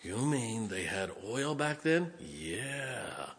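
soft, drawn-out mock question and yeah; hushed, put-on disbelief; mock incredulity. Preacher voices a doubter asking about ancient oil, mock incredulity.

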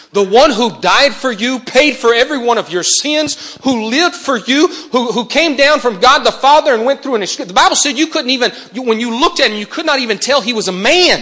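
high, loud preaching climax; preaching at full force. Acted. Preacher lifting the church to a loud, high climax. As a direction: pour it out over the congregation at full force.

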